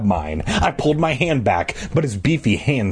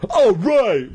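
high, excited whoop; exclaiming; 8 semitones higher than usual. A narrator's reading. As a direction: burst out with it in triumph. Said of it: "Moose exclaimed".

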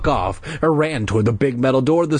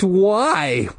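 squeaky; 6 semitones higher than usual. Acted. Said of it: "I squeaked".

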